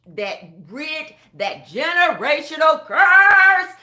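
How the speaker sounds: loud, slow preaching crescendo; preaching climax. Real, not acted. Preaching to the congregation about the generational curse, loud and slow. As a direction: drive it home like a preacher.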